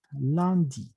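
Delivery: "lundi" with the U opened to an A. Shown as a demonstration. Teacher showing how the U changes, vowel as A. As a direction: say "lundi" as lan-di. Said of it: "Esta U se vuelve una A".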